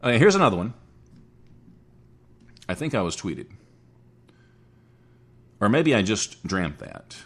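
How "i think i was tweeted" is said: ordinary talk, nothing marked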